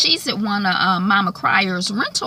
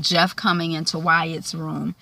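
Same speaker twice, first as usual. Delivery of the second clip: ordinary talk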